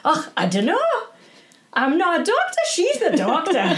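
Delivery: high-pitched imitated Scottish brogue; comic Scot protesting, voice climbing to the punchline; Mark's Scottish accent. Friend tells worried father he has the wrong person, Scottish accent. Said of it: "it was a Scottish accent".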